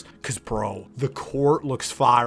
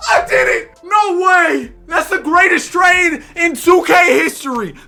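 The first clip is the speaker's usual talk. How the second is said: very high, loud victory yells; triumphant screaming; 12 dB louder than usual. Real, not acted. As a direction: shout your triumph to the whole room. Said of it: "a grown man screaming at the video game".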